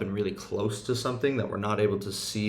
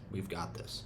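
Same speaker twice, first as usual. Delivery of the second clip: a hushed, steady reminder; gently saying it again, almost under the breath; soft reassurance; 3 semitones lower, 10 dB quieter than usual. Retold or imitated. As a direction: quietly settle a scared child. Reassurance repeated softly.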